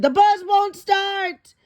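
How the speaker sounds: high, slow panicked wail; a kid realizing they're stuck. Acted. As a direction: dread as the engine fails. Bus stalls on erupting volcano.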